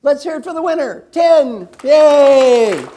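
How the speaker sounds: high, booming host's cheer; loud cheering. Real, not acted. Coach leads applause for the prize winner, loud excited cheering. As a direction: whoop up the room for a winner.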